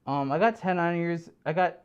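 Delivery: normal talking voice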